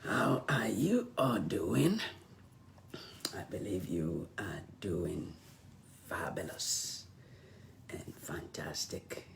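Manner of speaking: hoarse, voice nearly gone; losing voice. Real, not acted. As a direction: whisper through a lost voice. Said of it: "my voice is really gone".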